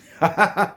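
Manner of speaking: a loud, high little laugh; short high laugh. Real, not acted. Wrapping up the Derek story, short loud laugh.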